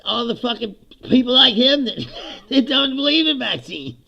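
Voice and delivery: can't keep from laughing in disbelief; laughing rant. Real, not acted. Laughs while ranting about RFK.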